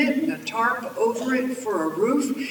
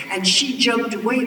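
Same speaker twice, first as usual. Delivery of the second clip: plain reading voice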